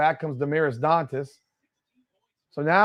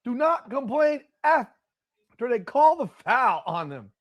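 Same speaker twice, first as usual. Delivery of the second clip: higher and louder, scolding hard; angry rant; 9 semitones higher, 5 dB louder than usual. Real, not acted. Fan yelling at Caitlin for complaining after the call, angry. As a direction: yell at the screen, fed up.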